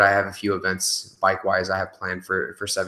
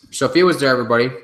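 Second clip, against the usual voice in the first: excited shout-out; 5 semitones higher, 8 dB louder, faster than usual. Real, not acted. Calling out viewer on stream.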